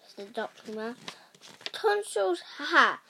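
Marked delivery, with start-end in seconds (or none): 1.7-3.0 a high, dismissive laugh at tonsils; dismissive cry. A narrator's reading. Actor Nigel waving off the girl's tonsils, scoffing. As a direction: brush it off with a scoffing ha ha. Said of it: "cried Nigel with a dismissive wave".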